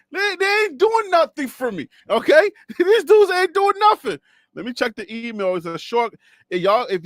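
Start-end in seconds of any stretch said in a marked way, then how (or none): 0.1-2.5 higher exasperated rant. Real, not acted. Host complains sources give nothing. 2.6-4.2 laughing it out, higher; amused scoff at useless sources; laughing disbelief. Real, not acted.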